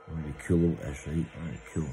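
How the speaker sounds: usual speaking voice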